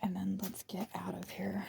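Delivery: soft, hurried-to-leave whisper. Real, not acted. Sneaking out past the snakes, whispering. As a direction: whisper it and slip away. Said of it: "I'm whispering, I'm being quiet".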